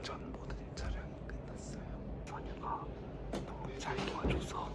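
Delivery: soft unvoiced whisper. Real, not acted. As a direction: whisper it, barely voiced. Quiet end-of-shoot wrap-up.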